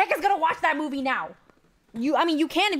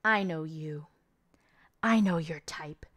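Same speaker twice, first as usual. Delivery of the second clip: low intimate accusation; 8 semitones lower, 4 dB quieter than usual. Retold or imitated. Main girl quietly sizing up the ticketer, low and hushed. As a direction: lower and softer, almost whispered menace. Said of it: "The main girl starts saying".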